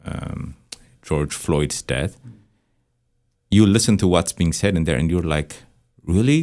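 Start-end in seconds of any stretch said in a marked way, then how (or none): 6.0-6.4 retold surprised "really"; higher-pitched, questioning surprise; mock surprise. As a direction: react as a surprised listener. Retells listener's surprised reaction.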